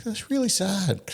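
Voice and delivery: put-on high, small child voice, saddened; gently imitating a little girl realising something sad; daughter's voice. Father quoting his young daughter's reaction in a high voice. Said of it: "She's like".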